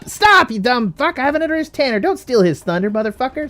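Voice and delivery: high, loud outburst, easing off in volume; worked-up, high-pitched scolding; exasperated yell. Real, not acted. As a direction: shout down an interrupting player. Cutting off player during outro.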